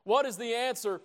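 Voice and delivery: ordinary talk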